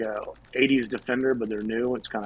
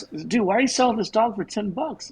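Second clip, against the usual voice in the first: puzzled customer; 9 semitones higher than usual. Retold or imitated. Joke: customer asks owner.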